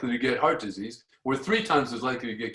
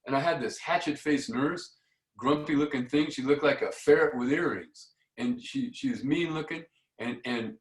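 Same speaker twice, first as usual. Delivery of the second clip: usual speaking voice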